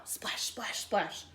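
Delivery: breathy whispered dashes. A narrator's reading. Reader voicing the bunnies in a whisper.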